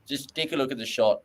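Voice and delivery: ordinary delivery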